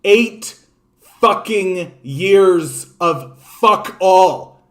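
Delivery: slow, every word hammered; seething slow emphasis; slow emphatic anger. Real, not acted. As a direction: spit out each word slowly. Angry rant, each word hit.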